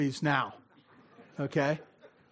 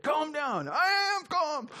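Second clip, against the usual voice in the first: squeaky high, strained insisting; mock frantic "I am calm" voice, pitched up high; high mock agitated voice; 11 semitones higher, slower than usual. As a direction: pretend you're tense while claiming calm. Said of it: "remember that".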